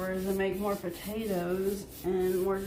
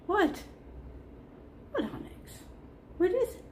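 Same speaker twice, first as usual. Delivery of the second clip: high pet talk; 10 semitones higher, 6 dB quieter than usual. Real, not acted. Cooing to her dog.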